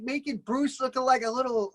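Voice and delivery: ordinary talk, nothing marked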